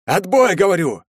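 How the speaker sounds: high, loud irritated order; раздражённый приказ. A narrator's reading. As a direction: cut the base off angrily. Злится на базу, обрывает связь. (раздражённо, громко оборвать)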